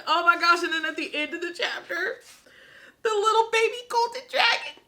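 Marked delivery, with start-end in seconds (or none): 3.0-4.8 gushing. Real, not acted. Gushing over baby dragon.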